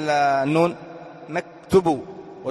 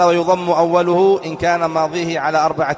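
reciting text; 2 semitones higher, 4 dB louder than usual. Acted. Teacher reads the grammar text to students, recited. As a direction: read it out like a classical text.

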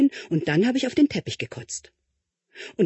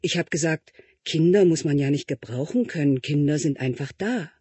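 plain reading voice

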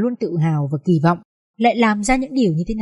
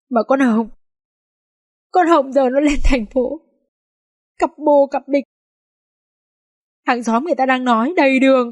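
tearful mother; 4 semitones higher than usual. A narrator's reading. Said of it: "đôi mắt nhòe nhoẹt nước".

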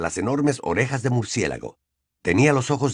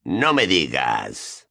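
low, slow, drawn-out scorn; scornful; 8 semitones lower, much slower than usual. A narrator's reading. Sirius scorns the elf from the doorway, low scornful drawl. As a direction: draw it out low and disdainful. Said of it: "miraba con desprecio al elfo".

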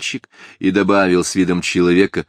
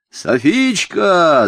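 long, high, loud call from a tree; drawn-out high shout; yelling; 11 semitones higher, 7 dB louder, much slower than usual. A narrator's reading. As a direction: holler the name across a distance. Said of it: "заорал он с дерева".

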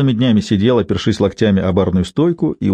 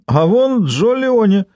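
high, drawn-out call; excited exclamation; 10 semitones higher, slower than usual. Acted. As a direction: point him out excitedly. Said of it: "воскликнул он".

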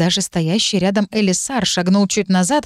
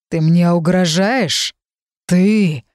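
growled breathing out; much slower than usual. A narrator's reading. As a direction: breathe it out hot and slow. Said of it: "Последнее я выдохнула".

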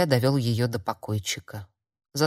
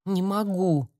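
higher, tearful whine; whimpering; 4 semitones higher than usual. A narrator's reading. Weeping young healer tells mentor she cannot, whimpering. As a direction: whimper it helplessly through tears. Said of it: "заскулила Айлиша".

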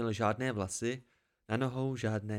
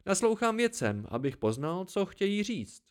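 a lively hermit delighted to see company; the old listener welcoming a weary traveller; cheerful old hermit; 8 semitones higher than usual. A narrator's reading. Old man's voice.

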